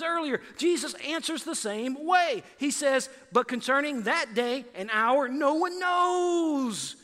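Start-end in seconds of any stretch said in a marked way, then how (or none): 3.3-7.0 higher, very slow, drawn-out shout on knows; dramatic booming proclamation. Retold or imitated. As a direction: thunder it out like a grand movie line. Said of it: "Jesus didn't say it like that".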